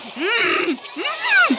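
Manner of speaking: hums squeak up sharply and crack. Real, not acted. Streamer humming along until his voice cracks. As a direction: let the voice break on a high hum. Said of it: "Napiyok, man".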